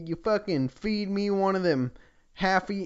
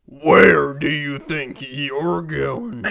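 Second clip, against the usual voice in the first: mock bellow; 7 dB louder, much slower than usual. Bass voice in the forest bellowing at Reimu, slow and loud. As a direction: boom it out slowly as a menacing voice. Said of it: "A bass voice bellowed".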